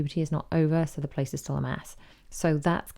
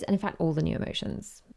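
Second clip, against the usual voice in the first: usual speaking voice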